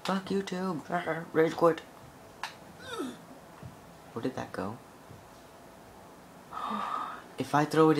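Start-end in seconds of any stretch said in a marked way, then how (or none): 0.0-1.8 high, squeaky mock outrage at no views; hammed-up angry viewer squeal; mock rage. Gamer fumes over zero views, high mock outrage. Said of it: "You're like".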